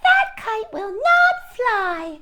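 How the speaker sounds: high, slow robin taunt; sing-song high bird mockery; jeering robin voice. Robins teasing Toad from the bush, high slow taunt. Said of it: "said the robins".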